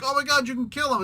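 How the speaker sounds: hammed-up rushed alarm; mock panic. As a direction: blurt it like an alarmed onlooker. Acts onlookers panicking at the blast.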